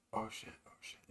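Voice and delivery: breathless starstruck whisper; hushed mock excitement; whispered panic. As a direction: whisper it like you can't believe it. Los starstruck in background.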